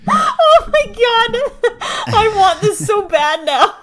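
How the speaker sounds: high, loud, helpless laughter through words; laughing hard. Real, not acted. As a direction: gasp it out, cracking up. Cracking up at the impression.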